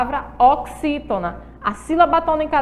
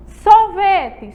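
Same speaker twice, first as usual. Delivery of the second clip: "Sorvete" loud and high on VE; teacher's model "Sorvete", stress on VE; stressed middle syllable; 6 semitones higher, 7 dB louder than usual. Shown as a demonstration. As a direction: punch the VE of "Sorvete". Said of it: "mas eu utilizo a letrinha E".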